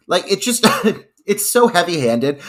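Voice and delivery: normal talking voice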